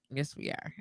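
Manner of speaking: whispered yes we are; whispered echo. Shown as a demonstration. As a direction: whisper it back softly. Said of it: "repeat whispered".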